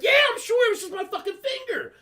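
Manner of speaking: high shout, then hurried explanation; exasperated protest. Retold or imitated. Son defending himself to mom, exasperated shouting. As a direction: protest loudly, way up in pitch.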